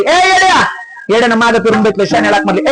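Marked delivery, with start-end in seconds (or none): 0.0-0.6 high acted hail; calling out. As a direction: call out to the fool from afar. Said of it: "शहाण्याने येड्याला हाक मारली". (उंच आवाजात हाक)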